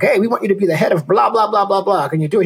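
mock Disney boss. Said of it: "And they're like".

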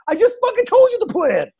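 high, hammed-up exasperated voice; mock exasperated dreamer. Mocking someone with no plan, high and exasperated. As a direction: play the lazy dreamer, fed up with the question. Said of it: "and they go".

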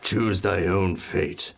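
slow, looming villain decree; dark lord. Acted. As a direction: pronounce doom like a tyrant.

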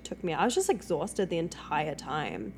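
ordinary talk, nothing marked

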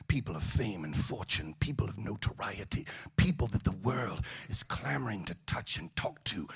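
a hushed, low impression of the preaching bishop; imitating the bishop. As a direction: lean in and speak the prophecy low and hushed. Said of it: "That's what he sounds like".

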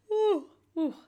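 a long open yawn; yawning sound; big yawn. Real, not acted. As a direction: let a real yawn out. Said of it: "that's when I yawn".

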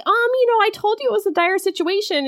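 very high, fast prissy voice; mock heroine defends herself. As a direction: squeak it quickly, defensive. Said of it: "she's like".

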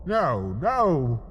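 usual speaking voice

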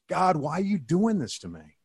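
a lightly done scream at the top of his lungs; retold scream. Alone on the bike path, crying out to God in a half-done mock scream. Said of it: "I screamed at the top of my lungs".

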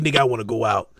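low, blunt demand; curt low answer; blunt girlfriend. Retold or imitated. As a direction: play her as the one in charge. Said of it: "she be like".

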